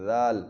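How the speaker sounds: ذال soft, without hiss or hardness; gentle ذال, no sibilant edge; soft zaal. Shown as a demonstration. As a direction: ease the ذ gently, no whistle. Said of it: "نرمی کے ساتھ".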